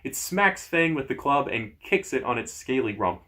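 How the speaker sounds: plain reading voice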